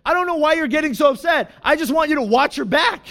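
high, fast imitation of an upset spouse; angry, frustrated wife. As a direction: snap back in exasperated frustration. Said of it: "in her anger, in her frustration".

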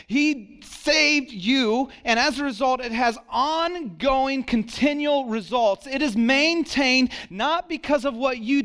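fervent preaching at full force. Acted. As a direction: preach it with rising fire. Preaching climax on grace.

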